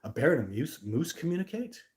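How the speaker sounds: incredulous. Real, not acted. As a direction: echo it back in amused disbelief. Repeats comment in disbelief.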